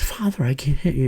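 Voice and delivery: softly voiced reluctant pupil. Young man refusing to hit the priest, imitated soft reluctance.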